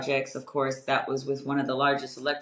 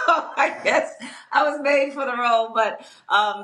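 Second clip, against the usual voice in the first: laughing through the line, higher and amused; 10 semitones higher, 4 dB louder than usual. Real, not acted. Leader jokes with interviewer about her strong voice, laughing. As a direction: chuckle it out at her own joke.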